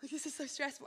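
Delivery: mock overwhelmed, with a gasp; retold crying. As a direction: act out a mild tearful fluster. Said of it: "like, crying".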